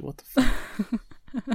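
high, slow mock shock; drawn-out, high, retold outrage; stunned disbelief. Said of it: "I was like".

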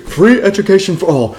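high-pitched comic politician impression; Bernie Sanders. Showing friends the candidate's main policy, comic impression. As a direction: cry it out like a stump-speech promise. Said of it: "This guy does the funniest fucking Bernie impressions".

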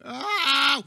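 high, loud cry of pain cut into a laugh; pained yell. Real, not acted. Laughing at the movie, then yelps high and loud in pain. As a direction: yelp out loud, hurt.

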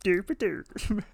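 playful Muppet chef nonsense; Swedish Chef. Retold or imitated. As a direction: drag out goofy chef gibberish. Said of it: "turn Hottie into the Swedish Chef".

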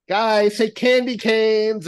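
high, drawn-out cue for a photo; photo-taking call. Retold or imitated. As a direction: sing out slowly to a group posing. Photographer cueing a picture, retold.

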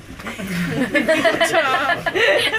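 real burst of high laughter; laughing at the joke. Real, not acted. Laughing after mocking the clingy partner.

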